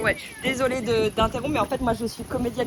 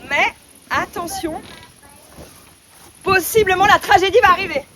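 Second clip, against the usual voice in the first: dramatic; 5 semitones higher, 7 dB louder than usual. Acted. Actress explains dramatically.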